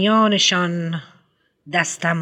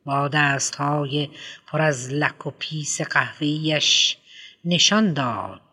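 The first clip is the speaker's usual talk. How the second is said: ordinary delivery, nothing marked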